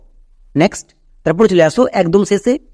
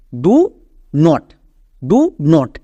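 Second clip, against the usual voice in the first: ordinary talk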